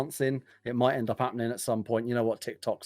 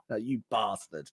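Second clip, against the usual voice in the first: slow, sneering quiet taunt; sneering panto villain; 6 dB quieter than usual. Retold or imitated. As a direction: gloat softly like a cartoon baddie. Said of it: "all you just hear is Fubar like".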